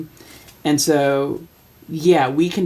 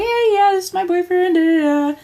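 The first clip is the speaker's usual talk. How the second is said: high cheerful voice; 14 semitones higher than usual. Retold or imitated. Introducing boyfriend.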